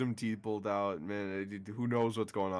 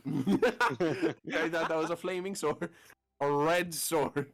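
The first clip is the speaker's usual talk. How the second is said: laughing, slow; 5 semitones higher, 3 dB louder, much slower than usual. Acted. Riffing on the sword joke.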